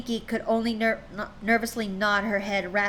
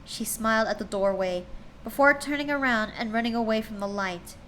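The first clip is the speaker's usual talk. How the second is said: plain reading voice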